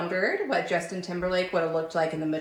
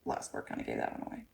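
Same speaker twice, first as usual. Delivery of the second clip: fast, much softer unvoiced aside; whispered aside; 13 dB quieter, faster than usual. Real, not acted. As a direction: throw it away under your breath.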